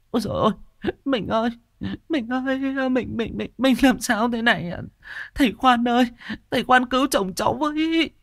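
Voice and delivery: high keening wail for her dead husband; wailing widow. A narrator's reading. Wife wailing over husband's body to the healer, high keening. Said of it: "vang lên tiếng nức nở".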